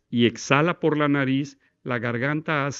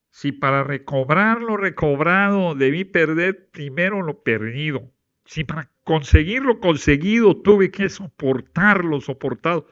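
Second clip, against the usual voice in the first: nasal guttural reading; 3 semitones higher, 5 dB louder than usual. Shown as a demonstration. Teacher demos a throaty nasal poem reading. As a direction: demo the wrong way, air out the nose. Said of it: "está mi nariz haciendo una pronunciación gutural".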